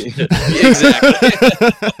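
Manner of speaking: bursts out laughing on the word; a real laugh that carries the word out. Real, not acted. Guest laughing as he agrees with the host's joke.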